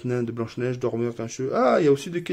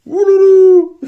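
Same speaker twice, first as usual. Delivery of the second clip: very high, loud dismayed cry; shrill mock alarm; alarmed ouloulou; 15 dB louder, much slower than usual. Real, not acted. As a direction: wail it, overwhelmed. Dismay at hard date card.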